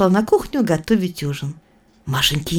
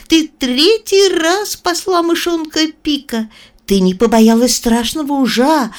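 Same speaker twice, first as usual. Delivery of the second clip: solemn mouse queen; 7 semitones higher than usual. Acted. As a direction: proclaim it like a royal mouse. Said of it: "Царица мышей торжественно пропищала".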